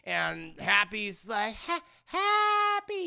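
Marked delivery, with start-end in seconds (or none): 1.6-3.1 put-on high, drawn-out baby's first word; Happy. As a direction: stammer it out slowly in a high baby voice. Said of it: "he says his first word".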